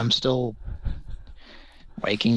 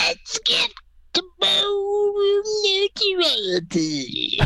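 much higher falsetto rock singer voice; screechy singer impression; much slower than usual. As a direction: screech it like a hard rock frontman. Falsetto [name] impression.

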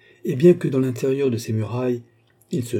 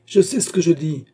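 loud, higher-pitched magistrate; irritated rebuke; 8 semitones higher, 6 dB louder than usual. A narrator's reading. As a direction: cut the officer down with authority. Magistrat rabroue l'agent. (réplique irritée, voix haussée)

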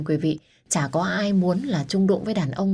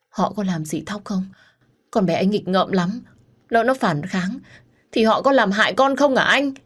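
worried questions climbing higher and louder; anxious; 4 semitones higher, 3 dB louder than usual. Acted. Mother asking ex-husband about kidnapped child, increasingly panicked. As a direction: let panic build phrase by phrase.

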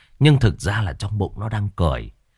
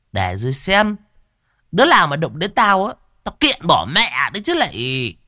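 smug threat; 7 semitones higher, 3 dB louder than usual. Acted. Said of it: "mụ chống nạnh cười thầm".

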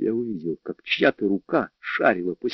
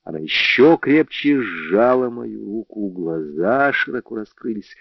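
usual speaking voice

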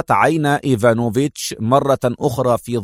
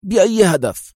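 quick; 7 semitones higher than usual. Acted. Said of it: "في سرعة".

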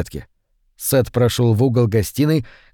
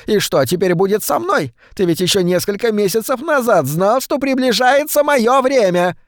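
put-on high, strained old woman's voice; old woman's whine; 9 semitones higher than usual. A narrator's reading. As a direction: complain like a fussy old wife. Wife's shrill complaint, old woman. (капризный голос старухи)

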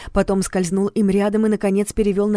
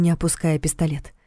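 ordinary delivery, nothing marked

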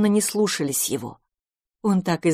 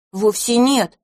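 offended; 6 semitones higher, 3 dB louder, slower than usual. Acted. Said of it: "обиделся мышевраг".

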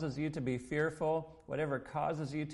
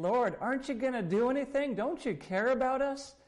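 high, fast, urgent pleading; acting out panicked, louder questions; freaking out; 9 semitones higher, 3 dB louder than usual. Disciples to sleeping Jesus in the boat, freaking out. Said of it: "they're freaking out and saying".

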